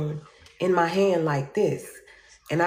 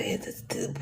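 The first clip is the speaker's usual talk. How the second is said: whispered disbelief; 10 dB quieter than usual. Retold or imitated.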